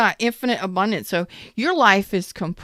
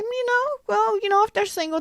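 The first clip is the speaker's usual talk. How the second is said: high, airy put-on voice of the ex; imitating the ex, much higher and softer; mock casual ex; 3 dB quieter, faster than usual. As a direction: toss it off like a flirty ex. Mocking the ex's casual message.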